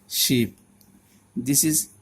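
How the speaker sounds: ordinary talk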